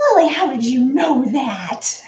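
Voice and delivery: amazed mom laughing at her daughter; laughing surprise. Retold or imitated. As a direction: ask it through a laugh. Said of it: "She goes".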